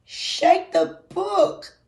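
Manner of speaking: high, loud frantic call; urgent plea. Acted. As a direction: beg the reader to hurry. Larry urgently begs reader.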